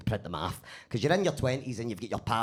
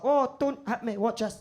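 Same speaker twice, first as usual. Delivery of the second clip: scared pleading; 9 semitones higher than usual. Retold or imitated. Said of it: "I'd be like".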